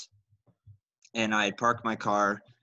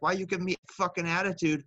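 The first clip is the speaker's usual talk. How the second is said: retorting back; 7 semitones higher than usual. Retold or imitated. Retold comeback at trucker.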